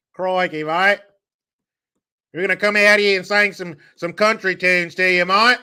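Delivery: high, hammed-up Aussie; mock Australian accent. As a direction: tease in a caricature Australian voice. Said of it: "You're fucking Australian".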